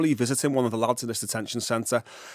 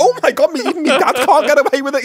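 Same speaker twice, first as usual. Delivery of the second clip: shocked disbelief; 11 semitones higher, 9 dB louder than usual. Retold or imitated. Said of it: "I was like".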